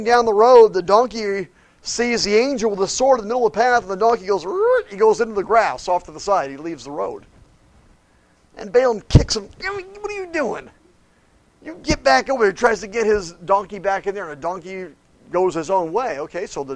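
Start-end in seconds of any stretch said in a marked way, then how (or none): 4.4-4.9 high squeal of a startled donkey; donkey noise. Retold or imitated. Storyteller imitating the donkey shying off, high squeal. 9.6-12.5 much higher, scolding the beast; mock angry rider. Balaam scolding his stubborn donkey, high mock yell.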